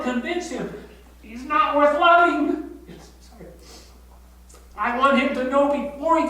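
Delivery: choking up at the thought; voice breaking. Real, not acted. Voice breaking over grandson's future.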